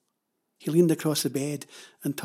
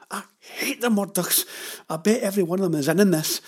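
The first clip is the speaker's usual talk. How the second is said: heated, higher-pitched angry outburst; furious; 5 semitones higher, 5 dB louder than usual. Acted. Jenny punches the pillow over the Murdochs, furious. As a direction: spit it out, building louder and faster. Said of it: "clenched her fists and punched the pillow".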